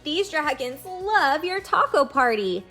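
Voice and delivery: ordinary talk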